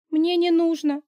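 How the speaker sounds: high whiny whimper; nanny whimpers to her boss, high and whiny. A narrator's reading. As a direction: whimper it, already beaten. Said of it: "хныкнула я".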